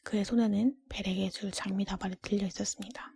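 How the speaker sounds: plain reading voice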